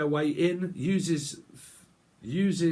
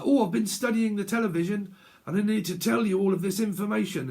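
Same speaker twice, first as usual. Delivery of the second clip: mock excited chatterbox, much higher; eager news-watcher; 8 semitones higher, 3 dB louder than usual. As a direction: gush out the news. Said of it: "they're like".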